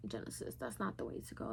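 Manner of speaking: soft, half-whispered note to self; hushed aside to herself; quiet self-talk. Retold or imitated. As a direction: say it under your breath to yourself. Advising herself in retold thought.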